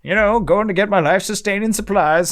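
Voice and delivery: high, loud grizzled prospector voice; old prospector. Retold or imitated. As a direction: play a cranky old gold miner. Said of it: "why I sound like an old prospector".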